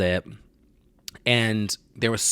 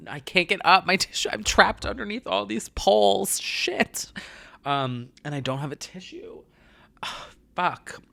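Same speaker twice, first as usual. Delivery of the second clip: strained, much higher voice, fighting a sneeze; holding back a sneeze; 10 semitones higher than usual. Real, not acted. Trapped under poles, about to sneeze, strained high. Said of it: "I'm literally about to sneeze again".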